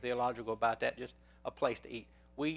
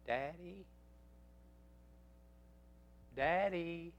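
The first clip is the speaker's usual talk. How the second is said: put-on small, higher child's call, drawn out; frail little voice; 4 semitones higher, 7 dB quieter, much slower than usual. Son calling from downstairs at night, frail little voice. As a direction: imitate a little boy calling softly for his dad. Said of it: "I heard a frail little voice".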